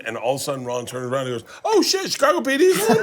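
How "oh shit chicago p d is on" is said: high, laughing, thrilled outburst; mock Ron lighting up at his show; excited Ron. Ron voiced turning around.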